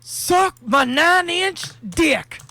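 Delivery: high, slow, loud mock scream; imitated scream. Stranger on the subway, hammed-up screaming. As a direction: bellow it like a lunatic on the train. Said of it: "he screams at the top of his lungs".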